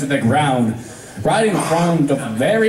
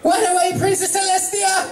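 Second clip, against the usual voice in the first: high, startled pony calling out; a flustered student greets her princess; flustered unicorn. Acted. Twilight panics at princess.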